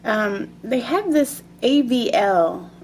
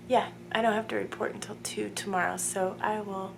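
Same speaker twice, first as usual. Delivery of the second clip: tired and quiet; 8 dB quieter than usual. Real, not acted. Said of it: "I'm so tired".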